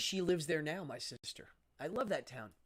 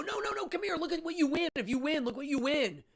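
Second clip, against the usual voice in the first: much higher, eager coaxing pitch; coaxing salesman; 10 semitones higher than usual. Retold or imitated. Staff coaxing a reluctant kid to enter, in an eager, much higher voice. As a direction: reel the kid in with the prizes. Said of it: "And then they go".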